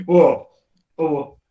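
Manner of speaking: ordinary delivery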